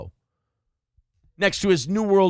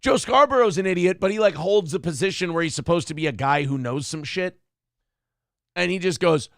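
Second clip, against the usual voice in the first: normal talking voice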